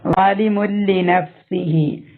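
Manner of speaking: ളാലിമുൽ ലിനഫ്സിഹി slow, n merged into l with no hum; merge without nasal. Shown as a demonstration. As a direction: run the n into the l cleanly. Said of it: "മണിക്കാതെ ലയിപ്പിക്കുക".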